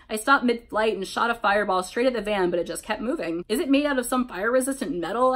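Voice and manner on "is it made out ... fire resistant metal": ordinary delivery, nothing marked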